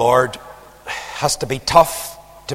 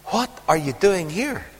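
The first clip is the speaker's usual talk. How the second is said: softer, measured reproach; quiet searching question; 7 dB quieter than usual. Acted. God questioning Elijah after the rebuke, softly and pointedly. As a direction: drop the volume and ask it pointedly.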